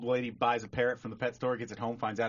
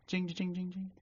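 high imitated bell, rapid repeats; door bell jingle; 8 semitones higher, 5 dB quieter than usual. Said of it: "the door just jing".

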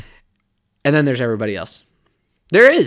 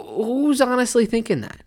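ordinary talk

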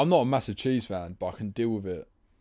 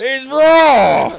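shrieked high and loud, outraged chef; Gordon Ramsay impression; 13 semitones higher, 14 dB louder than usual. Mates joking about pizza, shouted chef outrage. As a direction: scream it like a furious TV chef. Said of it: "Where's the lamb sauce?".

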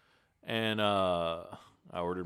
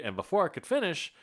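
ordinary talk

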